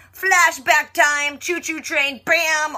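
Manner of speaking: loud, high, drawn-out mock fanfare; hyped announcement. Recapper announcing the flashback, high hammed-up excitement. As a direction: belt out each phrase like a showy announcer.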